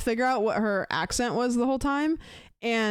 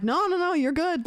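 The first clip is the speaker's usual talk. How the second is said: higher, bright quick reassurance; eager reassurance; 7 semitones higher than usual. Retold or imitated. Telling the busy agent to take her time, higher reassurance.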